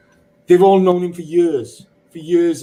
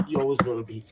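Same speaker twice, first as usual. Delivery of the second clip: much softer and quick, almost to himself; softly repeated; 3 semitones lower, 9 dB quieter, much faster than usual. Real, not acted. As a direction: let it fall away quietly, "always" barely pressed. Repeats tribute softly after firm take.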